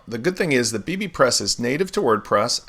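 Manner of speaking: ordinary talk, nothing marked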